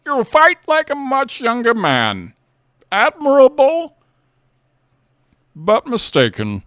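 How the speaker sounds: slow, high-pitched loud Bane voice; Bane impression. Asked by chat for his Bane, booming and slow. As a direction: drag out every word like a masked villain. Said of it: "let's hear your Bane".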